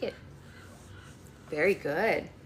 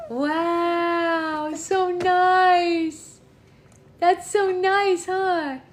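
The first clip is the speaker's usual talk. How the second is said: delighted; 9 semitones higher, 5 dB louder, much slower than usual. Real, not acted. Mother praising her child.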